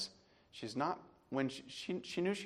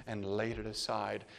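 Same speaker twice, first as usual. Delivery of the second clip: ordinary delivery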